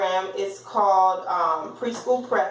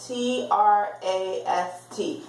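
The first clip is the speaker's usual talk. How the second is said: slow spelling out; slower than usual. Shown as a demonstration. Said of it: "let me sound it out".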